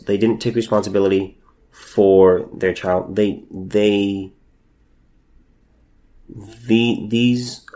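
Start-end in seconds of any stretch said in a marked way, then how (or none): none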